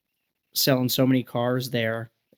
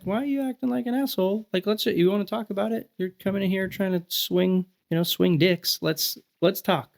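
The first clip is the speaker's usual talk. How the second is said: pitched high, needling a pushy customer; mock confrontational challenge; 7 semitones higher than usual. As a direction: call his bluff, high and cocky. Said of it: "I was just like".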